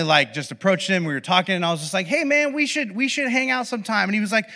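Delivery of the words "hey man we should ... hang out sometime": higher, casual guy pitching a hangout; friendly invitation. Retold or imitated. Acting out his friendly invitation.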